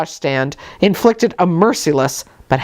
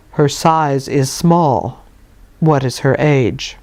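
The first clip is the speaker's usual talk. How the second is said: low, slow, solemn clergyman; solemn bass voice; 4 semitones lower, slower than usual. Acted. Stern visitor Brocklehurst inspects the little girl, low and slow. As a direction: weigh each word gravely, low. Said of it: "said solemnly and in a bass voice".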